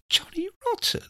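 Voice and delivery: hushed, very high-pitched disbelief; incredulous thought. Retold or imitated. Teller's disbelieving thought at name.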